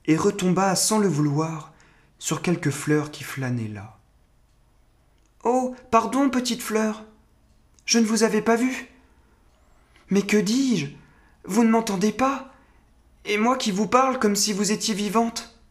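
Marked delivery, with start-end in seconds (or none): 5.4-15.6 put-on high-pitched little boy voice; high boyish voice, questions lifting at the end; young boy, apologetic. A narrator's reading.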